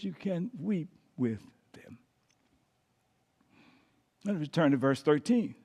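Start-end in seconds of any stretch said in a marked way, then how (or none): 0.0-2.0 hushed whispered aside. Shown as a demonstration. Preacher to congregation about mourning, whispered. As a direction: breathe it out in a whisper.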